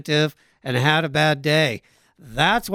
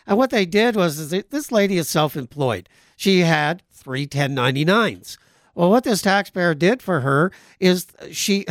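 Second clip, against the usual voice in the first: ordinary talk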